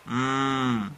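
drawn-out humming sound; voiced "mm". Shown as a demonstration. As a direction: hold "mmm" so the throat vibrates. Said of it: "Sienten vibración en sus manos".

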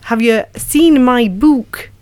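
slow, careful learner's Geordie; attempted Geordie accent. Shown as a demonstration. Actor trying out a coach's practice sentence, shaky Geordie accent. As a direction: try Geordie, drawing out each key vowel. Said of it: "then we had to say".

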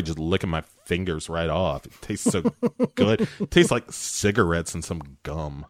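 ordinary delivery

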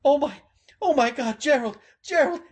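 high, loud joyful exclamation; overwhelmed with relief, calling out; overjoyed. Acted. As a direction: round man rejoicing over Gerald. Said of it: "round man".

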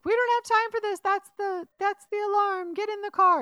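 high, loud hurry-up orders; imitated urgent yelling. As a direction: act out panicked accomplices rushing someone. Retold urgent shouting during prison break.

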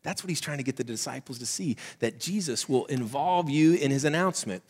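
ordinary talk, nothing marked

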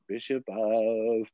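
a slow, hanging "Bishop of"; anxious echo. Retold or imitated. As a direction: repeat "Bishop of" slowly, waiting for the rest. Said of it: "and I was like".

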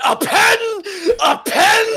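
put-on high, loud Irish drunk shout; drunk Irish guy. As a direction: bellow it like a drunk Irishman picking a fight. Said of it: "the drunk Irish guy grabbed him and went".

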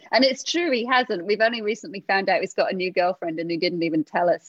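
usual speaking voice

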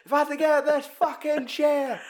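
high squeaky tantrum, laughing through it; bratty kid. Retold or imitated. Retelling the boy threatening his dad, laughing and squeaking. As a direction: shriek like a furious little brat.

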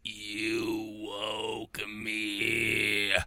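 low, slow, otherworldly rumble; ethereal djinn voice. Retold or imitated. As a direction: drag it out like a genie just woken. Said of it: "she hears this ethereal djinn voice".

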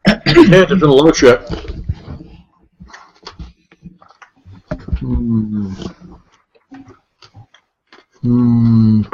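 talking around a sweet; words through a mouthful of candy; chewing while talking. Real, not acted. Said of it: "Sorry, I'm chewing my sweetie".

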